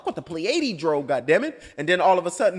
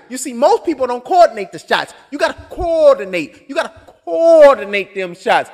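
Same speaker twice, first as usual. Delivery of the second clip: imitating the trainer; 5 semitones higher, 8 dB louder than usual. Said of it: "John Witherspoon being a coach".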